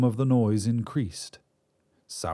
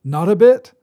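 put-on higher, much louder delighted old wizard; beaming; 9 semitones higher, 12 dB louder than usual. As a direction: burst out happily as the wizard. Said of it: "the wizard beamed".

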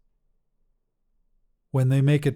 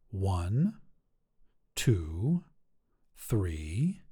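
soft, low counting to self; 4 semitones lower, 6 dB quieter, much slower than usual. A narrator's reading. Ari counting the door ritual, low and soft. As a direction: count it under your breath.

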